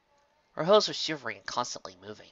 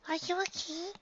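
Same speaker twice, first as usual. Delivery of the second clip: very high, softer filly voice; sweet high-pitched voice; 5 dB quieter than usual. Acted. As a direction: ask again gently. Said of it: "she asked again".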